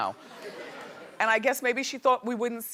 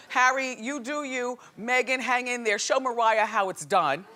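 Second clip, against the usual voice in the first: ordinary talk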